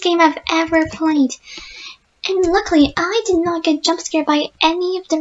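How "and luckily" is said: ordinary delivery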